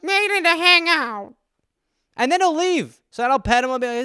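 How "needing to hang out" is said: very high, drawn-out meowing words; cat's voice. Retold or imitated. Cat pesters its owner for company, high meowy whine. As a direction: whine it like a needy talking cat. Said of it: "he's just sitting there like".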